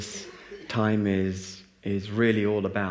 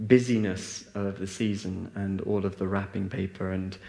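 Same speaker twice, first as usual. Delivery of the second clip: ordinary delivery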